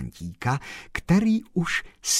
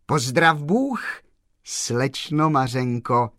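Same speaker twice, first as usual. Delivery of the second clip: calling out; 5 semitones higher, 5 dB louder, slower than usual. A narrator's reading. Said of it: "zavolal pan Kolbaba".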